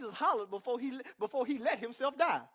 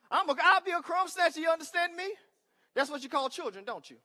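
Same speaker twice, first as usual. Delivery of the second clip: usual speaking voice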